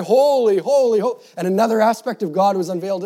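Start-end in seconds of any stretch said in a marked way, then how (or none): 0.0-1.2 loud, high holy; cried out. A narrator's reading. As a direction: shout it like the seraphim. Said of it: "And they cried".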